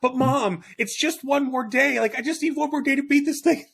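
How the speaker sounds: put-on high pleading kid begging mom; whiny kid voice. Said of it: "You're like".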